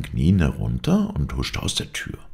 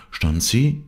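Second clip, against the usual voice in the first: ordinary delivery, nothing marked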